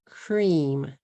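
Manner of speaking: "Cream" in one long falling beat; one-syllable English. Shown as a demonstration. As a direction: say "Cream" with the cr joined and a closed m. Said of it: "One syllable".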